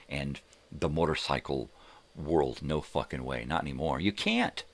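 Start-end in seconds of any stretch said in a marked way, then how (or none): 4.0-4.6 high-pitched you can't, rising; emphatic high insistence. Real, not acted. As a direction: squeak it out in disbelief. Insists, repeated.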